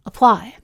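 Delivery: "apply" as uh-PLY; reduced "uh" opening "apply", stress on PLY; unstressed first syllable. Shown as a demonstration. Teacher lets students hear stress difference, first syllable reduced. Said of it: "You can hear this stress difference".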